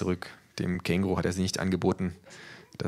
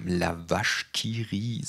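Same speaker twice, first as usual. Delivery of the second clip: the narrator nodding along, dry and unhurried; knowing nod; much slower than usual. A narrator's reading. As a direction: savour "La vache qui rit" slowly and approvingly. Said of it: "sage ich nickend".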